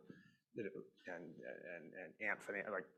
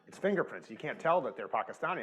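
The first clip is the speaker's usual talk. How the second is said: louder, higher, pressing the point home; insistent retort; 4 semitones higher, 5 dB louder than usual. Retold or imitated. Telling his Indian daughters again, exasperated and raised. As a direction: repeat it, now exasperated and emphatic. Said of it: "And I'm like".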